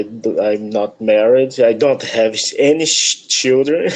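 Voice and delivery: ordinary talk, nothing marked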